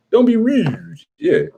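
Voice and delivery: high-pitched mock Baltimore accent. Baltimore local answering the baffled comic, high Baltimore accent. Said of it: "But Baltimore".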